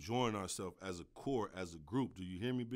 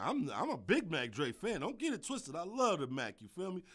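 usual speaking voice

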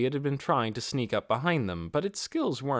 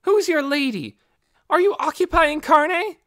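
much higher, loud angry challenge; threatening; 10 dB louder than usual. Acted. Inferia confronts the goblins about the village, furious and loud. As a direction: demand answers, ready to pounce. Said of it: "Inferia's threatening attitude".

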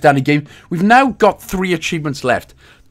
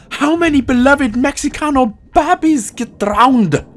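high, slow put-on voice; high and drawn out, mock sorrow; silly high voice; 9 semitones higher than usual. Voicing dead babies.

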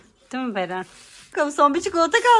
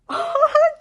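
high burst of laughter; short high laugh; 3 dB louder than usual. Real, not acted. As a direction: let a bright laugh burst out.

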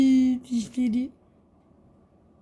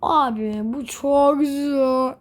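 slow whiny complaint; 2 semitones higher, 4 dB louder, much slower than usual. Real, not acted. Complains about difficulty.

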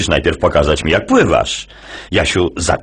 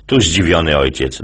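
ordinary talk

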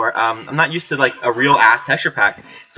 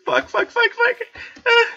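very high, rapid-fire panicked swearing; panicked squeal; slower than usual. Real, not acted. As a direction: squeal each word as you flee. Fleeing monsters.

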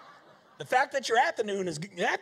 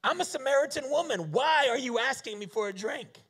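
higher, louder indignant woman voice; Samaritan woman; 7 semitones higher, 4 dB louder than usual. Acted. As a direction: play her shocked and offended. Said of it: "She said to Jesus".

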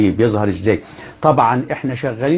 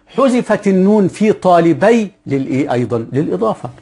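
usual speaking voice

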